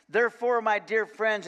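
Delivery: normal talking voice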